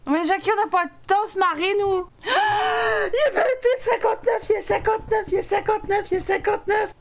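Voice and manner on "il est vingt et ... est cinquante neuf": high, urgent cries; gasp, then blurt the time in alarm; panicked countdown. Real, not acted. Panic as the deadline hits. (cri paniqué, voix aiguë et pressée)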